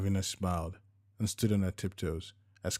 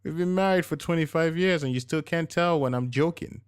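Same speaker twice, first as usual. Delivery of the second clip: higher, louder teasing wife voice; wife teasing husband about missing the joke; 9 semitones higher, 7 dB louder than usual. A narrator's reading. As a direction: poke fun affectionately.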